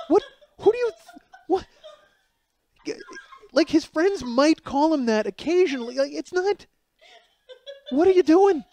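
sputtering, high-pitched loud disbelief; aghast. Retold or imitated. Husband aghast at his wife's gaffe, sputtering. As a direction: act out stunned, broken-off protests. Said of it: "And I'm slack-jawed".

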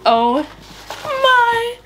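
real high, squeaky tearful laughter; overwhelmed, laugh-crying through the words; happy crying. Real, not acted.

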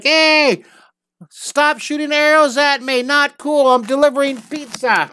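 high, loud shouting pizza guy; high-pitched panicked protest; pizza guy yelling. Retold or imitated. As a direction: yell up at the guard like a scared delivery guy.

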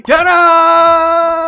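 loud high ta-da; big reveal. Acted. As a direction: announce it like a magic trick. Reveals crafted item to viewers.

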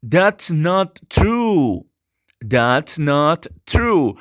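slow, crisp "true" with a hard T; strong T in true. Shown as a demonstration. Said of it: "la T es muy intensa". ("true" con una T muy intensa)

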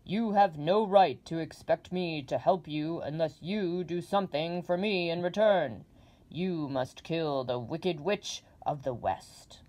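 loud, booming giant head; demanding Oz. Acted. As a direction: boom out the demand. Oz sets the task.

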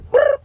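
short trilled phone sound; phone noise. Retold or imitated. Imitates phone dialling.

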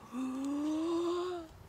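big dragon inhale; huge deep breath. A narrator's reading. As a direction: draw in a huge voiced gasp. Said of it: "deep breath".

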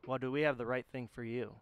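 infomercial voice. Pretend. Hater Shades mock infomercial.

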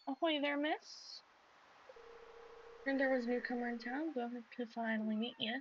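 put-on a light American country accent, greeting a newcomer. Fisherman greets the new farmer in a country accent. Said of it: "keep giving him country accent".

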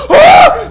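very high loud gasping shriek; startled gasp and shriek. Real, not acted. Streamer as the ghost spawns, startled shriek. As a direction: shriek in sudden fright.

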